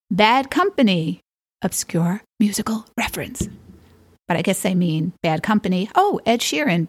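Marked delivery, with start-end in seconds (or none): 1.6-3.5 sly whispered aside. Real, not acted. To the viewers, nodding to a band name: sly whisper. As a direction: lean in and whisper the joke. Aside after reading bad company.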